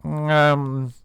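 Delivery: slow, pompous put-on critic voice; lofty critic drawling his verdict slowly; imagined music critic. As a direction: pronounce it like a snooty music reviewer. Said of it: "an impression of what I imagine he would be".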